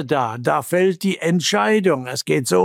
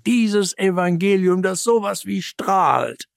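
high, sustained preaching fervour; ardent, raised-pitch proclamation; fervent exaltation; 6 semitones higher than usual. Real, not acted. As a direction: lift the voice in wonder. Exalts the radiant gospel.

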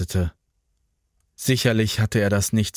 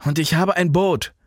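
higher, urgent and lightly winded; a touch out of breath, pitched up; panting; 7 semitones higher than usual. A narrator's reading. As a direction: push the news out between breaths. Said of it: "schnaufte der".

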